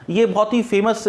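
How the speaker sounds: ordinary talk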